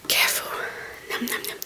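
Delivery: whispered, hushed words while feeding; soft whisper. Real, not acted. Mother feeding baby softly.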